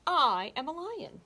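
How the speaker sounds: proud declaration. Acted. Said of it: "Hugo said".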